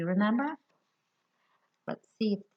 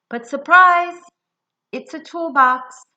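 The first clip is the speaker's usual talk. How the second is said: much higher and louder, a joyful reveal; spring the surprise on the kids; big surprise; 7 semitones higher, 14 dB louder, slower than usual. A narrator's reading. Surprise twist in story.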